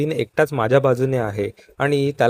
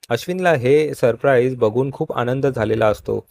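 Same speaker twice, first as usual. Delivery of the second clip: normal talking voice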